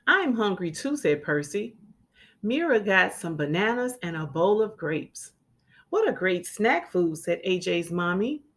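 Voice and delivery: clear, lively storybook reading; children's story read-aloud. A narrator's reading. Teacher reading a picture book to children, lively. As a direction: read it like storytime for little kids.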